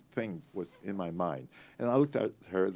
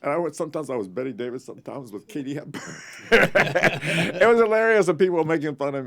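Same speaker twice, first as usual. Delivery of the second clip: higher and louder, laughing while telling it; 9 semitones higher, 11 dB louder than usual. Real, not acted. Telling how people teased his star impressions, laughing. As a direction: chuckle through the memory.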